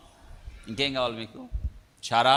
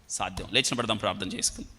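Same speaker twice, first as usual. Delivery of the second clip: ordinary talk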